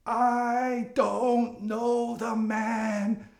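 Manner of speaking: high, very slow, drawn-out denial; frustrated. Retold or imitated. Peter denying Jesus a second time, frustrated and drawn out. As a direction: stretch every word in acted exasperation. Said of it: "Peter is frustrated".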